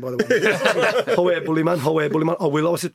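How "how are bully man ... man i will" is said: louder, repetitive mock Geordie; bad Geordie accent. Gazza brushing off a demand for the ball, mock Geordie accent. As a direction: imitate a Geordie protesting, badly and loudly. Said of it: "That's a shit Geordie accent".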